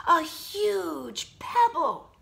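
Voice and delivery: high, loud, slow proud announcement; excited cry. Acted. Jessica presenting the huge pebble, excited. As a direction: show it off proudly. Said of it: "she shouted triumphantly".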